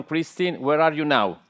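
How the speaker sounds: ordinary talk, nothing marked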